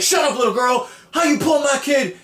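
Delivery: high, indignant mock scolding; freaking out. Dad silencing a little girl, hammed-up outrage. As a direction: snap at the child, then protest in a high voice. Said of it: "dad's freaking out".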